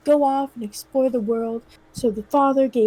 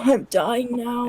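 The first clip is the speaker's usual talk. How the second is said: put-on dying old man; slower than usual. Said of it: "let me do the dying sound".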